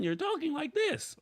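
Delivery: sudden jump into a high squeaky register; changed voice. Shown as a demonstration. Host showing viewers what inconsistent recording sounds like, sudden high voice. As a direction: pitch it way up all of a sudden. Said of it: "at a consistent volume".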